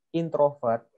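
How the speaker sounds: normal talking voice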